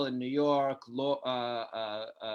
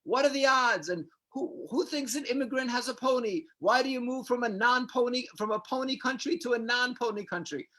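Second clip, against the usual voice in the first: high-pitched, exasperated comic rant; Jerry; 9 semitones higher than usual. Retold or imitated. Comedian reacts after the host storms off, high mock-incredulous rant. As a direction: imitate a sitcom comedian's incredulous complaint, pitched way up. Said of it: "Jerry's like".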